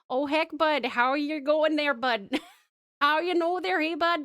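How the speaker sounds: put-on loud, high Canadian accent, hey bud style; a hammed-up friendly Canadian greeting. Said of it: "turning me Canadian".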